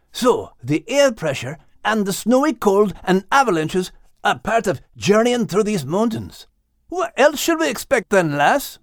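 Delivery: a loyal sheepdog asking his friend; Scottish dog Max. Acted. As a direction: Max asking Liz what to expect, lightly Scottish.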